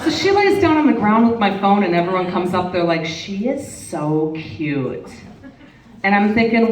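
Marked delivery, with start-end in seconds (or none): 3.1-5.1 put-on soft, slow cooing over a cute kid; slow doting coo. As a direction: coo it slowly and softly like doting strangers. Strangers cooing over child.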